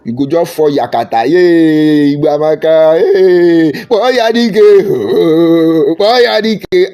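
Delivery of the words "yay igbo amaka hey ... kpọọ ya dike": loud, high hammed-up hype; mocking whoops and hails; mock cheering. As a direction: imitate a gullible crowd cheering a hero. Mimics crowd hailing flattery.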